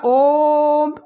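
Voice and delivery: ओम held long as in morning yoga; prolonged pluta vowel. Shown as a demonstration. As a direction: draw ओम out on a steady tone. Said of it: "यथा उच्चारणं भवति".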